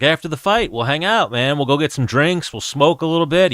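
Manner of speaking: eager retold invitation. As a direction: replay pitching a night out. Retelling his invitation to fighter.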